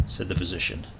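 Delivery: plain reading voice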